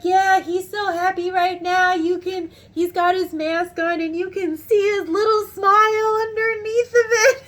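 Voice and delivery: high, loud, sing-song delight; imitated gushing. Supervisor describing his worker's smile to customer, gushing.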